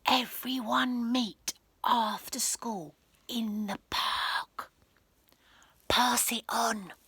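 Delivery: slow secret whisper; slow conspiratorial whisper. Acted. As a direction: breathe it into a friend's ear. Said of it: "Rebel whispered in her best friend's ear".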